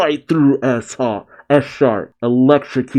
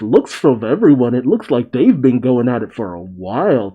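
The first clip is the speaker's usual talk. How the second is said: usual speaking voice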